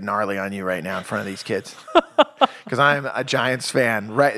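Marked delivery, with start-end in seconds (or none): 1.9-2.6 short high-pitched laugh. Real, not acted. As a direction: burst into a high laugh at your own joke. Joking about being Giants fan.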